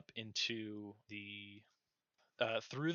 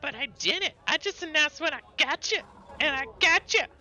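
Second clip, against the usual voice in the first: high, loud little-creature retort; squeaky critter protest; 11 dB louder than usual. Acted. Critter sassing the adventurer who scolded her, squeaky and loud. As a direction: talk back like a cheeky tiny critter. Critter answers back, voiced by DM.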